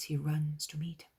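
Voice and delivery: usual speaking voice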